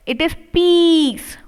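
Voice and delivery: peace with a slow held long ee; long ee sound. Shown as a demonstration. Teacher showing students the long ee in peace. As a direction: lean on the ee in peace. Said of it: "according to E sound".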